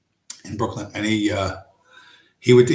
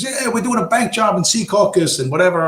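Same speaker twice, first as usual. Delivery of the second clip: higher, lightly voiced gangster quote; quoting Joe Pesci; 8 semitones higher than usual. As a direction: toss off a mob boast as Pesci. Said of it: "And Joe Pesci says".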